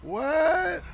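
very high, loud disbelieving shout; stunned shriek. Real, not acted. Stunned by the game's outcome.

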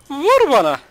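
high, loud mock catchphrase; Kerimcan Durmaz. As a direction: shout the catchphrase in imitation. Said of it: "Kerimcan Durmaz gibi yapardım". (tiz ve yüksek sesli taklit)

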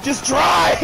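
urgent shout. Real, not acted. Panicked urging in a game.

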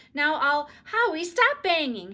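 put-on loud, high, slow snap at a child; scolding aside. A narrator's reading. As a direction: yell at Howie mid-sentence. Mother scolds child mid-call.

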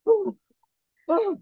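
two high mock gasps, spaced apart; mimicked high-pitched alarm noise; imitated freak-out gasps. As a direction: gasp like someone startled by you. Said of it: "they're all just freaking out".

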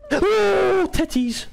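high loud startled yell. Real, not acted. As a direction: yell out in fright. Scared by the scream.

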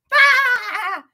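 high loud yelp; sudden yelp. Real, not acted. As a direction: let out a sharp yelp. Sudden shout.

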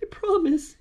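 high, small sniffling voice; meek little post-cry voice; sniffly promise. A narrator's reading. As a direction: promise it through pretend sniffles. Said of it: "The fox sniffled".

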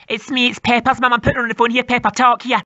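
low, rough cartoon mum; gruff mum voice. Mum snatching the phone from her daughter, low gruff mum voice.

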